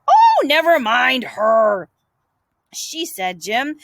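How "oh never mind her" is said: slow, louder brush-off; dismissive. Acted. Tom brushing off Jim's fear, slow loud dismissal. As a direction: wave her off.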